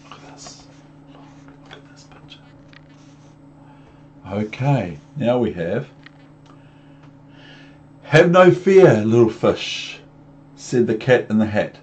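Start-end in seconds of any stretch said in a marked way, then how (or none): 0.1-2.4 hushed whisper to the listener; soft secret whisper. Real, not acted. Hushed aside. 8.0-10.0 cat voice. Acted. Cat reassures fish.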